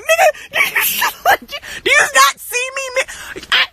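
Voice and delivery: high, strained mock outburst; exasperated yelling, played for laughs; retold flipping out. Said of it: "I'm just flipping out".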